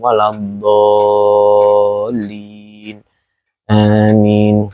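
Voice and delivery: even, level-pitched Arabic recitation; Quran recitation. Acted. Preacher leading Al-Fatihah before the sermon, Quran recitation. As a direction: recite Al-Fatihah on a steady tone with long-held vowels.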